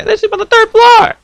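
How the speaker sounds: loud, high-pitched exclamation; exasperated outburst. Real, not acted. Son baffled he hears parents from the basement, loud and high. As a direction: raise voice and pitch in disbelief.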